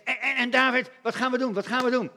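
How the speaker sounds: urgent stammering soldiers asking for orders; mildly panicked army officers; anxious generals. Retold or imitated. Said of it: "En die zegt".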